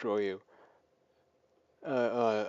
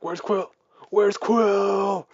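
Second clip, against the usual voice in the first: retold yell; 9 semitones higher, 10 dB louder, much slower than usual. Drax yelling as doors close.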